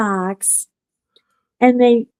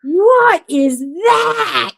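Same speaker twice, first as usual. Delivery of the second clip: higher, slow startled cry; startled shout; 7 semitones higher, much slower than usual. Retold or imitated. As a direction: cry out in shock at the noise. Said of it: "Joshua was like".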